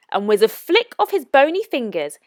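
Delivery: plain reading voice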